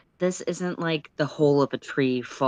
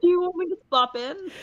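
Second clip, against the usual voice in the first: laughing; 13 semitones higher, faster than usual. Real, not acted. Laughing OOC offer.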